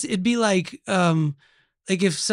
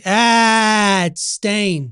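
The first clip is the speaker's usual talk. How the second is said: loud, drawn-out "Ah"; emphatic correction; 3 semitones higher, 7 dB louder, much slower than usual. Pretend. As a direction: blurt a loud mock correction. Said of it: "to correct everyone and say".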